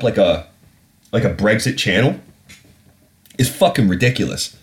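usual speaking voice

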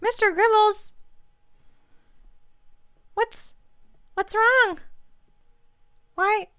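put-on high, small child's voice; anxious child. Child pleading with a pet guinea pig, anxious.

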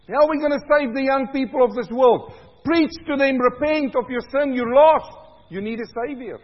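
high, loud fiery sermon voice; preaching at full force. Real, not acted.

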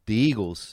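"the Eagles" said as duh Eagles; hard D opening "the" before Eagles. Shown as a demonstration. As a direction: say "the" as dee in "the Eagles". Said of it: "they put a D on it".

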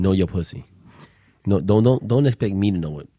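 ordinary talk

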